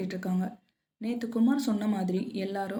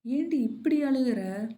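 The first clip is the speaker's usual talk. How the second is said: slow, higher, drawn-out question; exasperated questioning; 4 semitones higher, 4 dB louder, slower than usual. Acted. Kavya asks Priya why she cries so, exasperated questioning. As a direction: stretch out a friend's mild exasperation. Friend questions sobbing girl.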